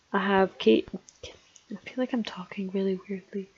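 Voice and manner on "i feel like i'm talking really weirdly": breathy whispered aside. Real, not acted. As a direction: drop to a whisper to yourself. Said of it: "I keep changing my voice".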